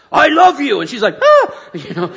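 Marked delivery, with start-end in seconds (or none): 0.1-0.8 put-on loud, high, barked at the baby; harsh loud snap. Shown as a demonstration. Dad snapping loudly at his baby to prove a point. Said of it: "It's more important how you say it". 1.2-1.5 high loud imitated baby cry; startled baby wail. Dad imitating his baby's upset cry.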